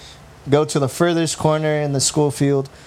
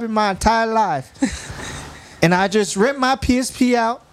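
put-on farm girl voice; 8 semitones higher than usual. Shown as a demonstration. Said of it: "you gotta read it like a farm girl now".

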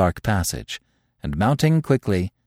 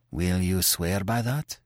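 hoarsely; 2 semitones lower, 8 dB quieter, slower than usual. A narrator's reading. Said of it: "asked Terry hoarsely".

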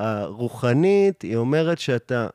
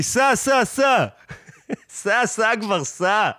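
tag mock impatient dismissal; 8 semitones higher than usual. Quotes the hipster waving them off.

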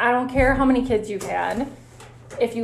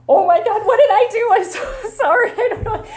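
high, louder, flustered apology with a nervous laugh; panicked inner voice; 12 semitones higher, 6 dB louder than usual. Retold or imitated. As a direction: blurt it out in a mock panic, voice shooting up. Panicking as patient cries.

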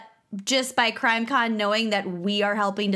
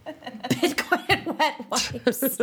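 laughing; 2 semitones higher, much slower than usual. Real, not acted. Laughing echo.

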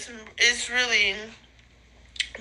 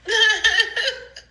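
high, loud burst of giggles; real cackling laugh, high and loud; laughter; 9 semitones higher, 5 dB louder than usual. Real, not acted. High-as-a-kite speaker cracks up at herself, laughing.

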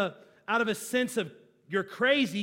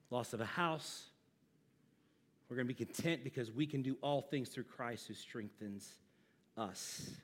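hushed; 8 semitones lower, 12 dB quieter than usual. Acted. Soft intimate close.

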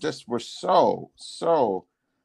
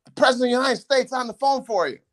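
breathless aide; 11 semitones higher, faster than usual. Retold or imitated. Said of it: "He was out of breath".

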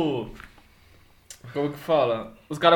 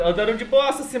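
ordinary talk, nothing marked

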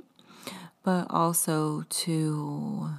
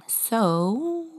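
voice cracking; 6 semitones higher, 4 dB louder than usual. Real, not acted. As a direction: voice cracks on a rising So. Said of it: "I think my voice just cracked".

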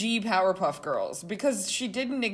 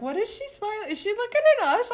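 mock alarm; 14 semitones higher, 8 dB louder, faster than usual. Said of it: "And I'm like".

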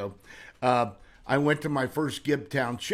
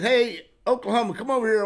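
retold loud call across the room, higher; 8 semitones higher, 5 dB louder, faster than usual. Tom hails a carnival friend over, loud call. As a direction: hail him over from a distance.

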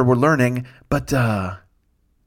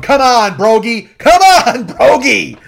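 high, loud re-enacted crowd yell; rowdy cheering; 9 semitones higher, 8 dB louder, much slower than usual. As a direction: egg him on, building to a full shout. Group cheering on thrower, retold.

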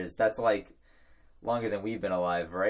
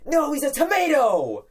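high, loud party yell; 9 dB louder than usual. Retold or imitated. Friend correcting overheard partygoers, yelled out loud and high. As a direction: shout it out across the room. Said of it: "I'd yell out".

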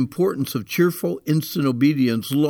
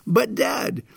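put-on higher drawn-out whine of a kid; whining child; 8 semitones higher than usual. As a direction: plead like a child avoiding a task. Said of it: "do you have a favorite excuse".

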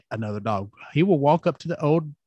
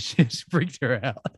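usual speaking voice

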